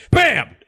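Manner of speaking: high, loud shouted bam. A narrator's reading. Narrator booms the wolf's blow on the door. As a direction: punch the impact.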